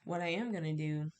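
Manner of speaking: normal talking voice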